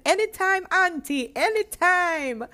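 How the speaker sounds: high, loud fake Jamaican accent; playful put-on Jamaican accent. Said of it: "my little fake Jamaican accent".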